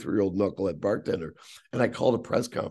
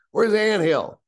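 higher, louder imitation of a holler; calling out, retold; 9 semitones higher, 6 dB louder, slower than usual. Team owner repeating the mispronounced name to the room, calling out. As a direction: mimic a boss bellowing for someone. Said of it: "Pronounce my name like Ann Hill".

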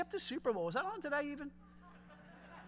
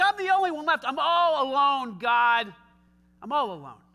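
loud, higher mock lament; self-pitying wail; 4 semitones higher, 10 dB louder than usual. Elijah crying to God he's alone, mock despair. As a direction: whine it like you're the last one standing. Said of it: "It's like".

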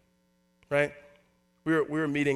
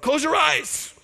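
higher and louder mock command; a playful raised cry; mock call-out; 12 semitones higher, 6 dB louder, much slower than usual. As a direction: call it out like a showy instruction. Mock instruction to onlookers.

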